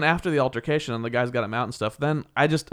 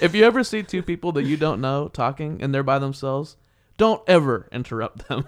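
ordinary delivery

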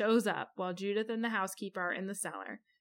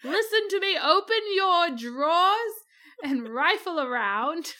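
mustache twirling villain; 7 semitones higher, 6 dB louder than usual. Retold or imitated. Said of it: "I'm twirling my mustache".

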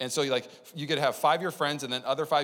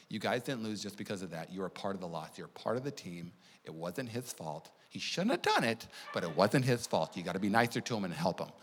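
gentle mom; 7 semitones lower, 6 dB quieter than usual. Retold or imitated. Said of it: "she's like".

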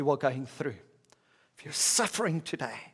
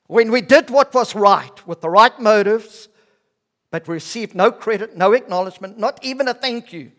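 loud, high, driving list; impassioned preaching; 6 semitones higher, 12 dB louder than usual. Real, not acted. Preacher voicing unrewarded service to the congregation, loud and impassioned. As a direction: pile up "no credit, no acknowledgement" with rising force.

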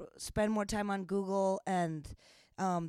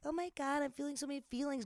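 higher, softer, hurried mock whine; mock emotional gushing; 5 semitones higher, 8 dB quieter, faster than usual. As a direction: mimic someone fretting in a small, high, quick voice. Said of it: "those of you who are like".

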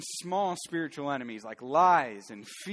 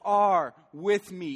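slow, higher and louder, each word held; crying out; 6 semitones higher, 7 dB louder, much slower than usual. Shown as a demonstration. A believer crying out in the valley, slow and loud. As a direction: cry it out to God like David. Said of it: "cry out like David and go".

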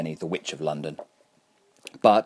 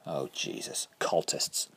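hushed, breathy dread under the breath; whispering; 6 semitones lower, 8 dB quieter, slower than usual. A narrator's reading. Captive's muttered dread under sack.

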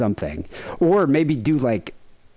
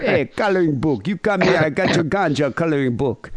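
higher, Jamaican dealer pitch; mock Jamaican accent; 5 semitones higher than usual. Dealer offering ganja to the tourist, mock Jamaican accent. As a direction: sell it like a beach hawker in a Jamaican accent. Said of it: "the tattoo was like".

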